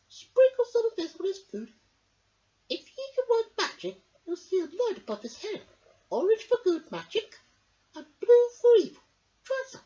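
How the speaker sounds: put-on high, loud crackly old woman voice; a wise old sister musing; old Myrtle. A narrator's reading. Myrtle talking to her brother, high and crackly.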